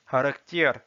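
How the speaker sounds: "Caractère" as Russian "kharaktyer"; Russian pronunciation. Shown as a demonstration. Teacher reading words with learners, "Caractère" in Russian. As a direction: read "Caractère" in Russian, starting "kh". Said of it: "s'entraîner à lire les mots".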